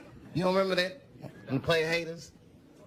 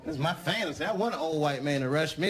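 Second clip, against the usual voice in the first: usual speaking voice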